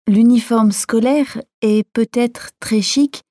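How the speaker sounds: usual speaking voice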